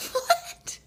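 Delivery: high, airy squeak of disbelief; flabbergasted. Retold or imitated. Woman hearing boyfriend blame pressure, flabbergasted. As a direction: gasp the word out, stunned. Retold shocked reply to boyfriend.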